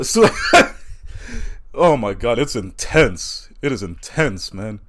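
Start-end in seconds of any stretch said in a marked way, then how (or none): none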